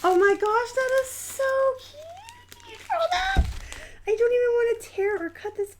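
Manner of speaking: squeaky, delighted gushing; cute-overload squeal. Real, not acted. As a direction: coo at something adorable. Gushing over cute hippo drawing.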